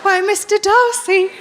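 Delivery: simper like a Regency heroine; hammed-up Austen lady asking about her bonnet; Pride and Prejudice lady. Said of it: "that inner Pride and Prejudice".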